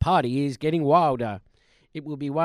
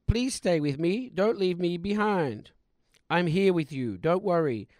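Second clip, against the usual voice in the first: ordinary talk, nothing marked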